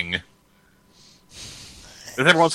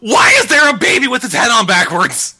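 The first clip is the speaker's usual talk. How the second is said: hammed-up scream of horror; mock horrified shout; 8 semitones higher, 5 dB louder than usual. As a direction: yell in fake terror.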